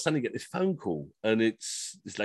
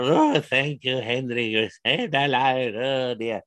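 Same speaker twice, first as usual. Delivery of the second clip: slow, lightly voiced old folks; frail old couple's thanks; slower than usual. Elderly couple thank Henry for saving them, slow and quavery. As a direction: gush thanks like a doddering pensioner. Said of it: "when they're".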